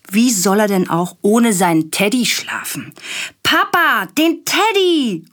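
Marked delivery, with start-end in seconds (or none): 3.4-5.2 put-on high, slow little boy call; demanding child. A narrator's reading. Willi calling Papa for his teddy, high child voice. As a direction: call for the teddy like a fussy four-year-old. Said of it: "ruft Willi".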